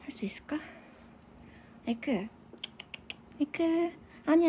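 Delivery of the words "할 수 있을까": hushed whisper to the pet. Real, not acted. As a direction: whisper it gently.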